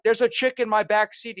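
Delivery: high, loud re-enacted panic; retold alarm. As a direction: frantically report an intruder to your cousin.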